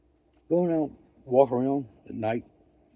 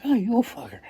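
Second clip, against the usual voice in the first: pitched up, loud delighted outburst; amused surprise; 9 semitones higher, 5 dB louder than usual. Retold or imitated. Narrator catching old buddy gambling, amused outburst. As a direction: catch your buddy red-handed and crow. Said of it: "I'm like".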